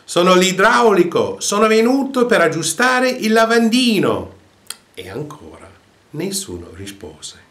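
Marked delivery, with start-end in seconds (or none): 0.1-4.4 much higher, louder angry shout; angry yell. A narrator's reading. Annoyed plumber yelling at the door, angry shout. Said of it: "E l'uomo gridò irrossamente".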